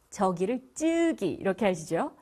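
ordinary delivery

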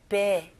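베, open e not bee. Shown as a demonstration. Teacher models 베 for the class, open e not bee. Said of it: "스펠링 보시면 이게 비이이지만 발음은".

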